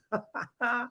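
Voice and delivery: short burst of laughter; amused laugh. Real, not acted. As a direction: laugh at being teased. Laughs at teasing about his accent.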